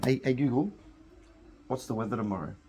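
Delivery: ordinary talk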